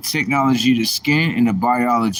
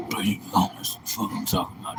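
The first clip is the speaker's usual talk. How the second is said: whisper; 7 semitones lower, 9 dB quieter, faster than usual. Real, not acted.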